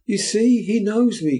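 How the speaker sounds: high, loud plea to the crowd; appealing cry. A narrator's reading. Nancy appealing to the bystanders, high and loud. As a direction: win the bystanders over. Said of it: "cried Nancy, appealing to the bystanders".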